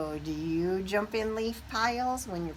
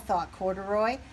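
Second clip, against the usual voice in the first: plain reading voice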